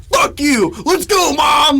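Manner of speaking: high, loud mock shout; defiant yell. Boy fleeing bullies yells to mom, loud.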